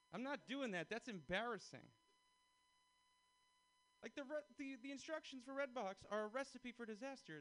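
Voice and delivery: high, loud comic rant; building rant. Real, not acted. To the crowd about Redbox, a loud rising rant. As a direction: pile up the outrage step by step.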